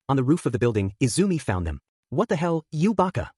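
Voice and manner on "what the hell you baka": understated shouted outrage; mild shout. A narrator's reading. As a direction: exclaim it high, without real volume. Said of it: "she shouted".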